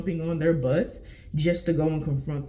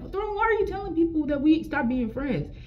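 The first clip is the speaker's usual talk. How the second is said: high-pitched exasperated questioning; imitating own indignant demand; retold confrontation; 9 semitones higher than usual. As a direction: complain in a high, put-out voice. Retold confrontation with former friend.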